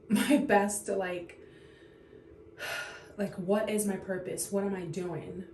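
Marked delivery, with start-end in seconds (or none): 2.6-3.1 soft, voiceless sigh of frustration; heavy sigh. Real, not acted. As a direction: breathe out wearily, lost for words. Searching for words while venting.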